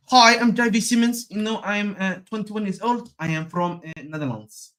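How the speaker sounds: terrible mock Dutch accent, starting high and sinking; Xavi Simons. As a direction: badly fake a Dutch footballer introducing himself. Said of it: "That was a terrible Netherlands accent".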